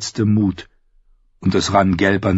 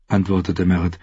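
ordinary delivery

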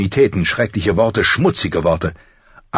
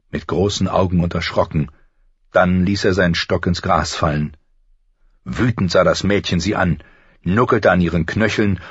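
usual speaking voice

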